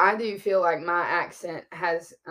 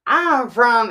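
loud, higher-pitched Louisiana drawl; deep Louisiana accent; 6 semitones higher, 12 dB louder than usual. Retold or imitated. Counseling teacher revealing where she's from, deep Louisiana accent. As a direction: imitate a teacher dropping her concealed Louisiana accent. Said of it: "actually talked how she would talk".

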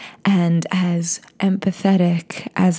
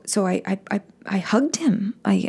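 ordinary delivery, nothing marked

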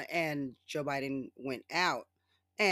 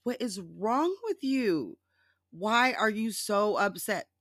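higher, slow mock concern; 5 semitones higher, 3 dB louder, slower than usual. Teasing Trump to viewers with slow mock concern. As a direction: ask it like soothing a tantrum.

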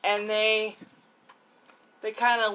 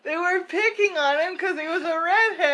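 laughing; 9 semitones higher than usual. Real, not acted. Telling it through shared laughter.

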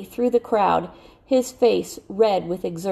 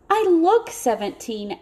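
much higher, eager plea; pleading; 9 semitones higher than usual. A narrator's reading. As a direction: beg to be believed. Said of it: "almost pleading".

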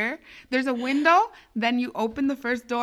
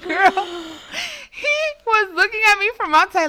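high, loud words squeezed out through laughter; telling it while cracking up; laughing while talking; 9 semitones higher, 4 dB louder than usual. Real, not acted. Teller laughing through the retelling.

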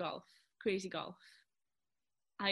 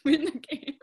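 laughing; 5 semitones higher, 11 dB louder than usual. Real, not acted. Laughing answer.

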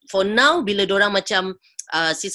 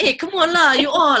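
snapping back, higher and louder; a mock irritated comeback; annoyed retort; 9 semitones higher, 6 dB louder than usual. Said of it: "answer back tau".

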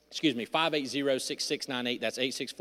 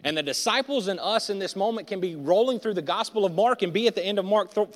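loud, driving sermon delivery; preaching at full force; 7 semitones higher, 6 dB louder than usual. Acted. Pastor preaching to church, loud and driving. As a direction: preach it out to the congregation.

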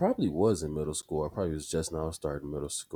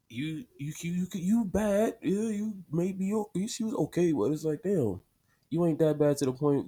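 high, loud, sputtering retort; indignant put-down; 12 semitones higher, 5 dB louder than usual. Retold or imitated. Answering the eye-rolling woman in his head, exasperated.

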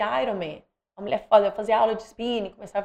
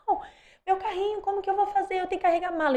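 higher, mock-desperate pleading; retold despair; 9 semitones higher, faster than usual. Mother at the airport over her broken pram, desperate. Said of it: "Eu entrei em desespero".

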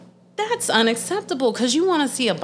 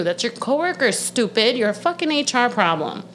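ordinary delivery

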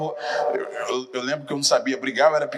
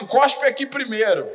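higher, louder schoolyard dare; mock dare; 8 semitones higher, 3 dB louder than usual. Kid daring a rival to start the fight, higher mock dare. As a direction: dare him to spit first. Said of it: "Ainda falava assim".

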